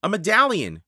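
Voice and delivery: calling out a find; excited shout. A narrator's reading. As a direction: shout to get her attention. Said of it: "shouted Jack".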